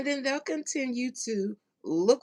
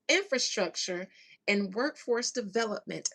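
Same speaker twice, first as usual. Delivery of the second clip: ordinary talk, nothing marked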